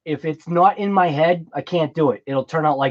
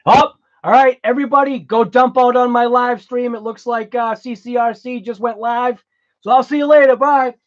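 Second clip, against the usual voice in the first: playfully raised, higher voice; mock hurried farewell; 7 semitones higher, 5 dB louder than usual. Streamer jokingly shooing viewers to another live stream, mock farewell. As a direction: send everyone off in a jokey high-pitched rush.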